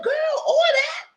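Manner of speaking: way up high, stretched out, mock outrage; girl, really, sky-high disbelief; high shocked squeal. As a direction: shriek it like you can't believe her. Retold outburst at Tyler.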